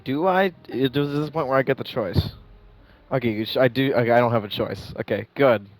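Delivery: ordinary talk, nothing marked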